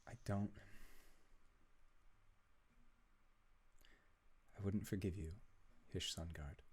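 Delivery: softly voiced, intimate in-character plea; hushed. Wife confiding to her husband at night, hushed. Wife's tearful bedside scene.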